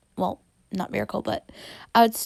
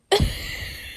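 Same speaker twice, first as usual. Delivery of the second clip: breathy giggle. Real, not acted. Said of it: "silly, goofy, happy".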